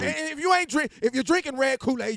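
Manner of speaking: high, loud joking rant; mock outrage. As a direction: pile on with playful indignation. Escalating the joke challenge.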